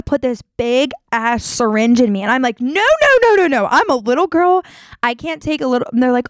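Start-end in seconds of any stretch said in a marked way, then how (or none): 2.6-5.9 high-pitched, pleading re-enactment of refusing; retold panicked protest. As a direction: act out a frantic "no", pitch leaping up then easing. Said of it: "I'm screaming and crying".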